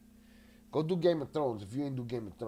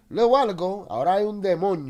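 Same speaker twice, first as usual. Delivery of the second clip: loud, high angry outburst; sudden frustrated yell; real anger; 5 semitones higher, 9 dB louder, faster than usual. Real, not acted. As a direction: shout it out, fed up. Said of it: "boy, I could break some shit".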